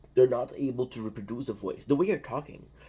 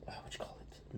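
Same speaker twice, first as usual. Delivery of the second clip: whispered aside while searching for a word; very soft, voiceless question to self; asking himself under breath; 16 dB quieter than usual. Real, not acted. Speaker to himself, hunting for a French term's translation, whispered.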